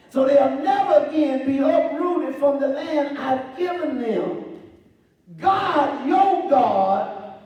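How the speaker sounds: high, loud proclamation; preaching. Acted. Preacher proclaiming God's promise to the church, loud and high.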